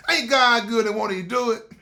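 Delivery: loud, high, full-force preacher call; said like I mean it. Shown as a demonstration. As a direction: belt it out like you mean every word. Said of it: "Let me say it like I mean it".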